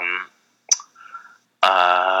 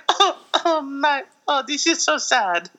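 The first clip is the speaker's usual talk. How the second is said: very high, hammed-up weepy voice; badly faked sobbing in a put-on accent; mock crying; 13 semitones higher than usual. Podcaster imitating the crying actress, mock weepy voice. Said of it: "she starts crying".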